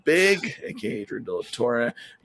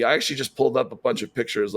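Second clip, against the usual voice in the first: ordinary talk, nothing marked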